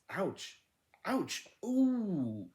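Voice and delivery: high, rising yelps of pain; two ouches and a long high ooh. A narrator's reading. Alcohol put on bites and scratches.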